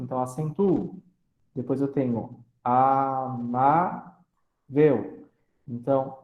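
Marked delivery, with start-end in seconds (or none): 2.6-4.2 "amável" slowly split as a-má-vel; syllable by syllable. Shown as a demonstration. Teacher separating syllables for class, "amável" drawn out piece by piece. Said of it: "faço a separação silábica".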